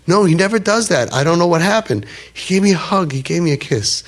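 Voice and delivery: high, breathless excitement; a boy who can't contain himself; boy telling the teller his Zaidi hugged him, high and excited; excited grandson. Retold or imitated. Grandson excitedly reporting the hug.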